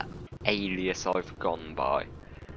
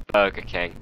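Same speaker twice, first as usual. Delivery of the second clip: ordinary talk, nothing marked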